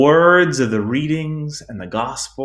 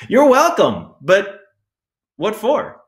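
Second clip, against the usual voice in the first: high-pitched, loud polite reply trailing into a puzzled question; pitched way up, automatic courtesy; quick chirpy reply; 10 semitones higher, 4 dB louder than usual. Retold or imitated. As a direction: answer brightly on reflex, then wonder why. Acted instant cheery reply, then puzzled.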